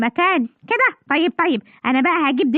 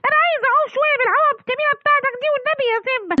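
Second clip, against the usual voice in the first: put-on high childlike begging voice; a sweet-talking kid begs his friend for the float; pleading child; 7 semitones higher than usual. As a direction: wheedle like a little kid.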